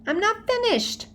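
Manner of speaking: put-on high whiny child protest; protesting little boy. A narrator's reading. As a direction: insist like a small kid, high and pleading. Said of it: "Fudge shouted".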